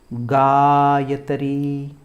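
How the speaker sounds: गायत्री chanted slowly with a long first vowel and long final ee; गायत्री intoned, each syllable held; Vedic pronunciation. Shown as a demonstration. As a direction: stretch गायत्री out as gaa-ya-ta-ree. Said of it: "Hindi में तो हम सीधा इसको पढ़ देंगे".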